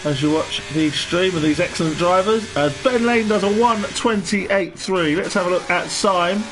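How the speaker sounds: game commentator. Acted. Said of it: "Why do I sound like a game commentator?".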